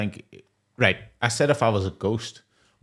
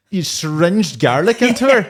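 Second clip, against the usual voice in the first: incredulous outburst; 8 semitones higher, 7 dB louder than usual. Real, not acted. Incredulous retort, laughing.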